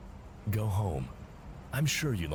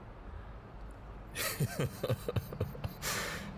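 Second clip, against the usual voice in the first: a quiet, brushing-off chuckle; soft dismissive laugh; 5 semitones higher, 6 dB quieter than usual. Acted. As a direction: laugh the worry away softly. Boss scoffs at ethics concern.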